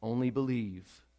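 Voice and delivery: soft, slow, hushed comfort; gentle reassurance. Acted. God comforting someone in the room, soft and slow. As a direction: ease it out softly, almost to one person. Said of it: "Maybe God wants to say to somebody".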